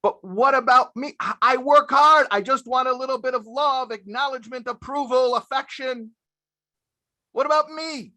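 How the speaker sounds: high, loud whiny complaint; complaining husband. Retold or imitated. Husband protesting to the rabbi, high whiny complaint. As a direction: whine like a husband who feels neglected. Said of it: "men will complain and they'll say".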